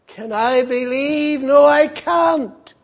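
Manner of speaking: high, loud cry of despair; anguished outcry. Acted. The child's father crying out to the Savior in anguish. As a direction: cry it out in agony, near a shout. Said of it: "He cried out".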